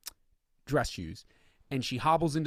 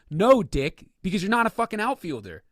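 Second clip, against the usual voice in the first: louder, higher exasperated retort; irritated rant; 7 semitones higher, 6 dB louder than usual. Real, not acted. Ranting at the player's comment, loud and exasperated. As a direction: snap back in disbelief.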